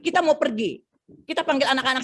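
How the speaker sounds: normal talking voice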